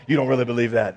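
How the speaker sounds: lightly mocking Jedi mind control, low voice; Jedi mind trick. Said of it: "some kind of Jedi mind trick".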